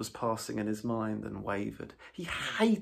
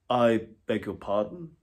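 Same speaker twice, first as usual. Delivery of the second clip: slow rat; 6 dB louder than usual. A narrator's reading. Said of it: "said the rat slowly".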